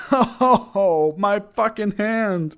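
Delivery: loud, high, slow cry of pain; pained yell. Real, not acted. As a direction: yell as something bites you. Pet bites her hand.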